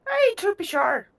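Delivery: high, hammed-up stage-Irish catchphrase. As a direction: ham up the Irish cliché. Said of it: "I haven't done Irish".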